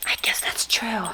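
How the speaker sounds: soft voiceless whisper; whispered aside. Real, not acted. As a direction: murmur it to yourself. Aside while reading a card.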